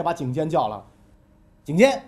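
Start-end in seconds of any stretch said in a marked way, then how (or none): 1.6-2.0 loud summoning call of the minister's name; the king calls his minister over, raised and commanding. Acted. Duke Xiao summons Jing Jian, a loud call.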